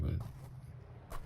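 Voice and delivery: whispered confession, almost entranced; helpless whisper. Acted. Confessing to a friend that the light drew them in, whispered. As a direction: whisper it like you're still under its spell.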